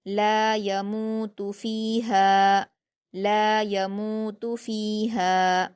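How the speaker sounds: ला यमूतु फ़ीहा chanted slowly, long ला, मू, फ़ी and हा; stretched madd. Shown as a demonstration. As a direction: hold the long vowels of ला यमूतु फ़ीहा, य and तु short. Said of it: "इसे दो हरकत खींचकर पढ़ेंगे".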